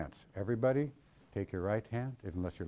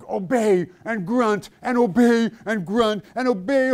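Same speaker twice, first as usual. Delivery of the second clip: grumbling obey-and-grunt voice, a bit higher and louder; mock grudging obedience; 9 semitones higher, 7 dB louder than usual. Pastor mocking sulky, grunting obedience. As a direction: play the sulky servant who obeys with a grunt.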